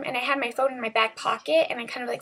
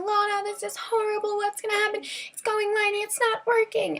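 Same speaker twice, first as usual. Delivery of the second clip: high, frantic crying plea to her friend; panicked plea; 9 semitones higher than usual. Retold or imitated. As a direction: wail it out in panic, voice way up. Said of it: "I was like".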